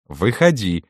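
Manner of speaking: ordinary delivery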